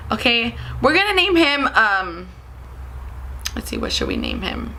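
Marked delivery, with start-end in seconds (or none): none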